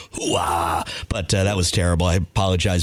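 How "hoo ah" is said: bad Al Pacino. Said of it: "all I got is".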